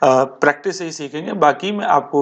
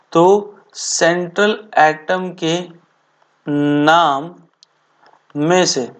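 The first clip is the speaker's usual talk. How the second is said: drawn-out words, long gaps between phrases; slow, deliberate teacher's dictation; much slower than usual. Acted. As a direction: dictate piece by piece for note-takers. Dictates a rule for notes.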